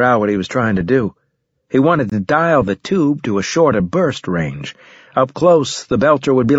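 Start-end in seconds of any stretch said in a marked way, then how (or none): none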